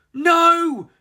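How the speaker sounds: loud high no; cry of alarm. A narrator's reading. The chickens crying out to stop Rocky, loud and high. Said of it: "cried the chickens".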